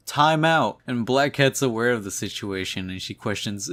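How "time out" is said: higher, louder call to stop the fight; mock yell. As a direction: shout it like a referee halting a brawl.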